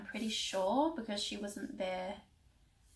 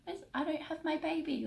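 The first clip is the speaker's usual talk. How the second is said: much higher, a hint of a sob in the voice; retold bawling; 8 semitones higher than usual. Mum retelling crying to her family without her baby, mild sob. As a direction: cry it out the way you did to your family. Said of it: "bawling my eyes out".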